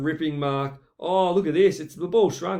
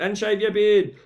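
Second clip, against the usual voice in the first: crowd heckle; 4 semitones higher, 6 dB louder than usual. Acted. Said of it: "Someone in the crowd yelled out".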